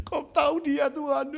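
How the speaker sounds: put-on man complaining to God like a child; childlike prayer. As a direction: pray like a sulking child. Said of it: "Kayak anak kecil ngomongnya".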